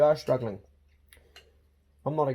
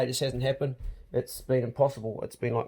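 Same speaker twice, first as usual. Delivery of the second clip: ordinary talk, nothing marked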